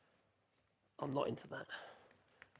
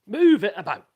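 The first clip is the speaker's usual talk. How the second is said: loud instruction; 5 semitones higher, 16 dB louder than usual. Shown as a demonstration. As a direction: call it out loud and firm. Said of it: "That's what you gotta do".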